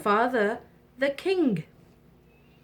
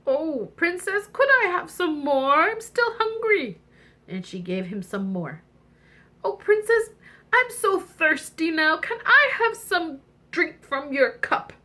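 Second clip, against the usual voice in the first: whining frog voice; 7 semitones higher than usual. Acted. Frog begs for more.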